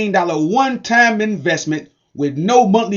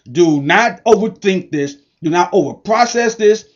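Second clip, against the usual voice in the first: ordinary talk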